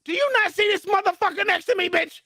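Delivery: high, loud yelled comeback at the attendant; reliving the fight with the bellhop, yelling; angry retort. Retold or imitated. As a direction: snap back at her, furious. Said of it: "I'm like".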